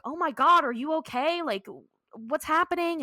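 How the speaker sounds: acted-out startled concern; alarmed concern. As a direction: imitate worried women rushing over. Women rush to crash victim.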